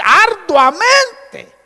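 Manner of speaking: "arduamente" stretched out very slowly, high and loud; emphatic word. Shown as a demonstration. Preacher stressing the verse's key word, very slow and loud. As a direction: drag out "arduamente" high and hard. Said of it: "Aquí dice".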